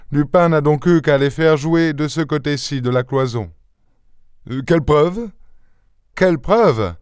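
the detective explaining calmly; un Holmes légèrement joué; Sherlock Holmes. Acted. Holmes dialogue voiced.

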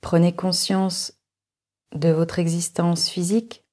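ordinary talk

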